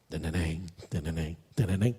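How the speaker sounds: low, soft instrument noodling; imitated music. Preacher mimics worship music starting, soft vocal imitation. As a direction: hum out a quiet mock intro riff.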